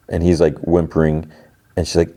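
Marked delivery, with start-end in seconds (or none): none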